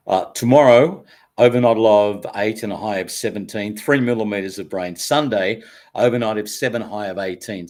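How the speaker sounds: upbeat radio weather read. Shown as a demonstration. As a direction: read the forecast like a DJ. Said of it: "with your radio voice".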